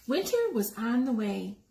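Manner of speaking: ordinary delivery, nothing marked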